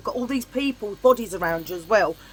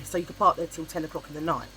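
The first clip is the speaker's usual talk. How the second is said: ordinary delivery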